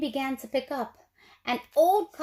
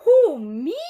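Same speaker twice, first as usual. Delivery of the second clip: much louder startled question; surprised owl; 2 semitones higher, 11 dB louder than usual. A narrator's reading. Owl startled that Hank offers a hug. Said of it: "said the owl".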